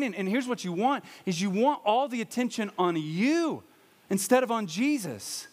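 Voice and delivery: ordinary talk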